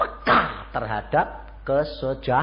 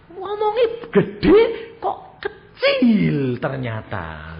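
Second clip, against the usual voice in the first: much higher, slow, whiny crying complaint; mock complaint; 4 semitones higher, 7 dB louder, much slower than usual. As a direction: whine to God like a let-down child. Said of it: "aku bilang".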